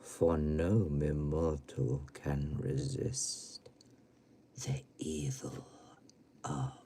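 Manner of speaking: slow, ominous low intoning with sudden swells; drawn-out macabre verse; spooky horror recital. Pretend. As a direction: recite like a horror-film host, dropping to whispers.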